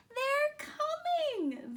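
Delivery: very high, loud, slow gasp of joy; excited moon. A narrator's reading. The moon seeing the astronauts approach, breathless and thrilled. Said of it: "the moon said".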